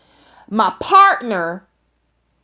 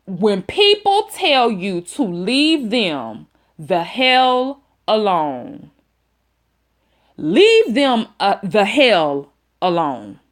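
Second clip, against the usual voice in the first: slow emphasis; slower than usual. Real, not acted. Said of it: "moral repeated slowly for emphasis".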